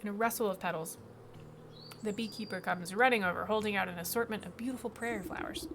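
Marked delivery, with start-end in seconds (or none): none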